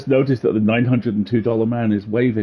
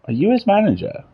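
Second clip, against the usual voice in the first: character voice; 8 semitones higher than usual. Acted. Voicing a game character.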